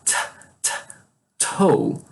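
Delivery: "T, t, toe" with bursts of air on each T; aspirated T. Shown as a demonstration. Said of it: "Ele usa mais ar".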